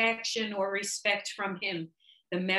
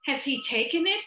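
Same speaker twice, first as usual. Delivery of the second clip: startled question; 9 semitones higher, 4 dB louder, faster than usual. A narrator's reading. Nurse startled by the news.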